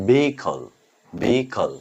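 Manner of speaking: ভেহিকল said with no h sound; careful recap of ভেহিকল, h silent; silent-h pronunciation. Shown as a demonstration. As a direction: say ভেহিকল as "vee-ikal", h dropped. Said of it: "এর উচ্চারণ হচ্ছে".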